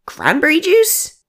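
stunned question, voice climbing to a squeak; incredulous echo. Acted. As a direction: repeat it back in disbelief. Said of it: "Aaron raised his eyebrow".

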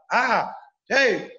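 sharp high yelled calls to wake a sleeper; retold shout. Comedian shouting from the stage to wake a sleeping man. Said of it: "Y gritaba".

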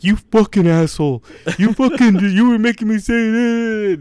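high-pitched mock outrage; imitating outraged co-host. Host replaying his co-host's morning call, mock-outraged. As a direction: whine the accusation high, drawing out the end. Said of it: "And you were like".

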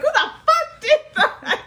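slow, high, loud comic outrage; mock angry yelling. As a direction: drag out the accusation, half laughing. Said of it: "you just wanna yell at him".

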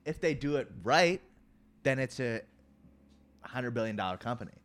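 loud, rising, enthusiastic pitch; excited. Real, not acted. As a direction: sell the big upside eagerly. Excited about potential.

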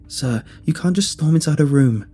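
firm protest. A narrator's reading. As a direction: stop someone barging in. Nurse stops narrator entering the room.

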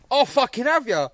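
high, loud mock aggression; acted threat. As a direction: imitate a punter squaring up for a fight. Acting out threat in row.

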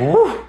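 a high whoop of relief; high woo. Real, not acted. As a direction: blow off the flub with a high woo. Relief after fumbling a word.

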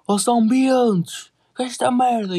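high, slow, drawn-out indignant yell; angry stranger's yell. Retold or imitated. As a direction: play the outraged big guy catching kids. Stranger confronts them angrily. (grito indignado, agudo e arrastado)

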